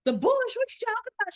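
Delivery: incredulous mom. Retold or imitated. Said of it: "And I was like".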